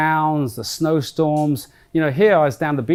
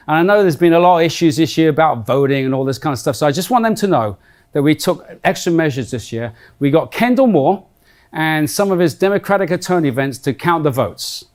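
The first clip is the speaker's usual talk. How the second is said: ordinary talk, nothing marked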